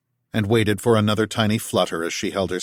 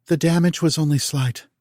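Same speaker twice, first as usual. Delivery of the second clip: lightly voiced higher, even machine calm; 8 semitones higher than usual. A narrator's reading. Nick reporting to Tal, lightly voiced calm AI. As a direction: speak like a detached medical AI.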